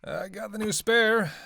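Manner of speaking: calling out. Acted. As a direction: call it across to someone, slowly. Calling out on returning to car.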